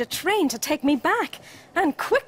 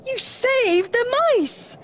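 slow, high-pitched cheer; high, jubilant storybook voices; 11 semitones higher, 4 dB louder than usual. A narrator's reading. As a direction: cheer the rescue, stretching the words. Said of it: "they cheered".

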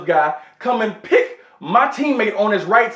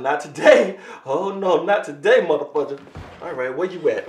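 ordinary delivery

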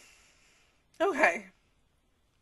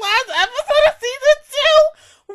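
crying shout; 12 semitones higher, 15 dB louder than usual. Real, not acted. Fan to the show about the funeral, crying shout. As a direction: howl the question in despair. Upset at the plot.

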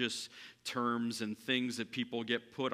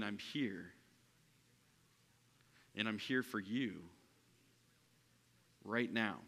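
gentle reassurance; 3 semitones lower, 6 dB quieter than usual. Retold or imitated.

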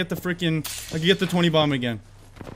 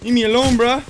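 louder plea; 5 semitones higher, 4 dB louder than usual. Real, not acted.

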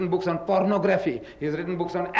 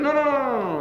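teacher's refusal; 7 semitones higher, faster than usual. Retold or imitated. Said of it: "He said".

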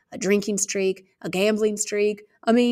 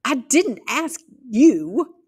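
put-on loud, high, slow child's snap; indignant retort; 6 semitones higher, 6 dB louder, much slower than usual. Girl rounding on her aunt, loud high indignant. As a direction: spit it back at the aunt. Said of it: "I wheeled around, startled".

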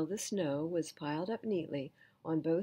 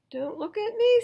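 much higher, louder alarmed protest; Eeyore protesting; 12 dB louder than usual. Acted. Eeyore protesting he won't be the horse, high loud. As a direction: squawk it in alarm.

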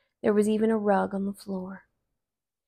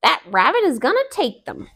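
Brer Fox; 5 semitones higher, 4 dB louder, faster than usual. Acted. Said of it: "said Brer Fox".

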